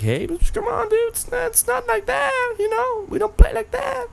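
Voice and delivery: very high put-on dude voice; mock Apple voice. Apple brushing off France's demand, squeaky mock protest. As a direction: plead in a squeaky mock falsetto. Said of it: "But Apple was like".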